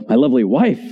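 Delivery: corrected phrase with the accent on "wife"; stress on "wife". Shown as a demonstration. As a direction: let "lovely" pass and land on "wife". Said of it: "The emphasis is sometimes on".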